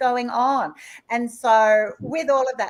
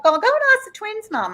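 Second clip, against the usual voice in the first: mocking other parents; 13 semitones higher, 6 dB louder than usual. People calling her twins mum.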